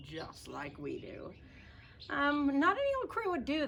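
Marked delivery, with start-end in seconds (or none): 2.1-3.7 squeaky puppet voice. Pretend. Puppet voice interjects.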